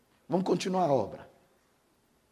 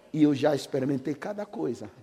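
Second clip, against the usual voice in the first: ordinary talk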